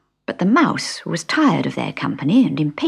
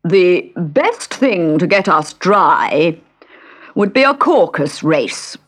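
loud, very slow pompous bird; pompous old dodo; 2 semitones higher, 5 dB louder, slower than usual. Acted. Dodo voiced in story.

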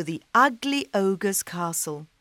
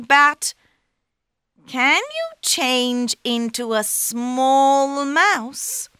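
cat voice; 7 semitones higher, 5 dB louder than usual. Acted. Cat flatters ogre.

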